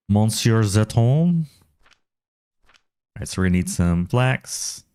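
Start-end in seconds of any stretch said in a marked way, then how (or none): 0.1-1.4 slow mock French Monsieur Zeton, nasal mon-sjor; playfully Frenchify Monsieur Zeton; hammed-up French try at a viewer's name. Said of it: "How do you properly say your name?".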